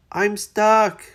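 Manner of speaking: a stuck hippo's loud wail; crying out stuck. Acted. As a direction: call for help, stuck fast. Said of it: "cried Hippo".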